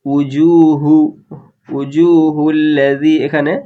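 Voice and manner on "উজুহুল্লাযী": উজুহুল্লাযী with the ll held double, slow; উজুহুল্লাযী recited slowly with a doubled l. Shown as a demonstration. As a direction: lean on the ll of উজুহুল্লাযী. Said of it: "তাসদিদের কাজ করতে হবে".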